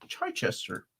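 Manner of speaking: Chichester as "CHAI-chester", high start then falling; playful pronunciation. Shown as a demonstration. Joking about having no tea, playful pronunciation. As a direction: softly repeat Chichester with a chai-tea first syllable. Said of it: "I don't even have my tea".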